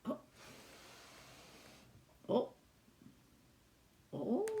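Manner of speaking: ordinary delivery, nothing marked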